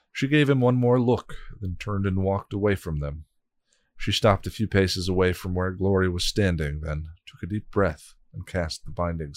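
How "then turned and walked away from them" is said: ordinary delivery, nothing marked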